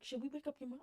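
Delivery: soft, cautious question; retold night-time conferring; hushed suggestion. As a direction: ask it under your breath. Cousins conferring secretly at night.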